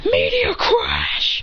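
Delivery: slow, high crying-out attack name; attack shout. Acted. Fighter unleashing Meteor Crash, slow high shout. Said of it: "He says".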